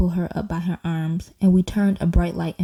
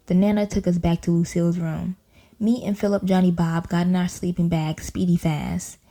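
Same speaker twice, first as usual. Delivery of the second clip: ordinary delivery, nothing marked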